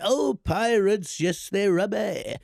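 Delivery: a kid's bad Jamaican accent, dragged out slowly; slow cringe-worthy Jamaican accent; mock Jamaican accent. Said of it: "I was gonna do the accent".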